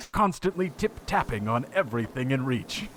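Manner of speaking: hammed-up British wildlife narrator; terrible British accent. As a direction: narrate gravely in a badly faked British accent. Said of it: "here's my terrible British accent".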